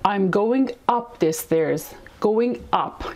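very slow, carefully spaced words; slow clear classroom example; slow model sentence. Shown as a demonstration. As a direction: spell it out slowly for learners. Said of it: "یا مثلاً".

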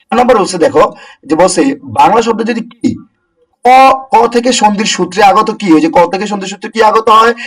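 ordinary talk, nothing marked

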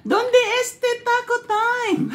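high, slow put-on Spanish phrase; mock Spanish. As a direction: try out tourist Spanish, laughing. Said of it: "Am I, am I talking right?".

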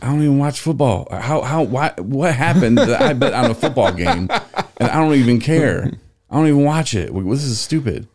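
exasperated mock whining about being roped in; baffled protest. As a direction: sputter in mock disbelief, stumbling over the questions. Retold friend protesting a lost bet.

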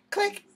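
high, loud mouth click sound; high phone click. Retold or imitated. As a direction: mimic the phone slamming down. Mom hangs up.

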